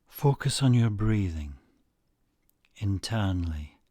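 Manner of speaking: unhurried guided-relaxation delivery; guided meditation. Acted. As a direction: lead listeners calmly, phrase by phrase, leaving space after each.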